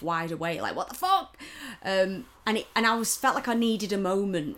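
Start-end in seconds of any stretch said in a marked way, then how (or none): 0.8-1.4 shocked. Retold or imitated. Retelling the shock at the slap.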